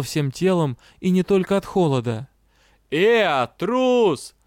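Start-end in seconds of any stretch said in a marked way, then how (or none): 2.9-4.3 loud, high jeering shout; childish taunt. A narrator's reading. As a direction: call it a coward like a schoolboy. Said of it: "продолжал измываться".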